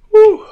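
sudden high whoop from the heat. Real, not acted. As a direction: let out a sharp yelp of pain. Reacting to hot wing.

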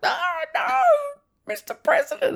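squeaky high pleading little man; Addison NPC voice. Retold or imitated. Gamer imitating the signpost man Addison begging, in squeaky falsetto. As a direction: beg in a tiny falsetto, way up high.

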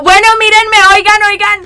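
high, loud imitation of calling everyone over; mock attention-seeking call. Teasing a friend about their dancing, loud high mimicry. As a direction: call out, demanding to be watched. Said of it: "fuiste como".

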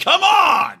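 loud, high yell of frustration; frustrated shout. Retold or imitated. Driver yelling at the stuck cat, a loud frustrated shout. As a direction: yell it out like you're begging the car.

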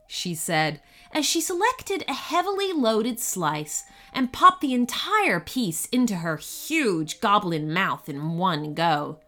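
ordinary delivery

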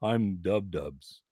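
low, slow, dopey demo voice; mock-silly introduction, pitched low. Shown as a demonstration. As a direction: drop way down and drag it out. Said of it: "you wanna know a voice I use for silly things? I talk like this".

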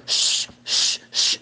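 long, forceful-sounding shushes, mimicked; repeated drawn-out "shh"; imitated loud shushing. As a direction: imitate someone shushing over the prayer leader. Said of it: "as loud as he could".

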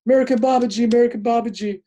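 high, loud, eager imitation of the sadhu's plea; pleading sadhu. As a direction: beg him for a teaching, pitched well up. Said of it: "he goes".

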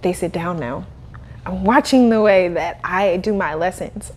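usual speaking voice

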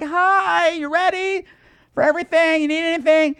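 high, sing-out mock friendliness; gushing neighbour. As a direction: greet them in a high, phony cheery voice. Gushing neighbourhood couple imitated.

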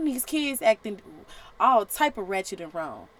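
ordinary talk, nothing marked

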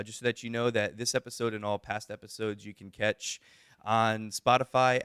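ordinary delivery

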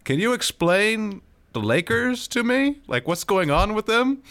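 attempted Trinidadian accent. Uncle asking about the Lakers, attempted high Trinidadian accent. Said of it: "I'm gonna attempt the accent".

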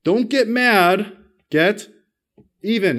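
slow, loud, drawn-out slogan; slow punchline. Shown as a demonstration. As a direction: let the crowd finish it, slowly. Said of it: "finish this phrase for me".

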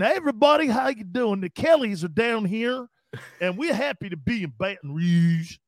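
mock a Boston coach putting on a Cajun accent. Host mocking a coach's fake Cajun accent. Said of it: "Cajun accent".

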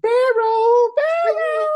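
shrill high shout of the word; sudden high-pitched shout; loud high call. Real, not acted. Loud high call amid chatter.

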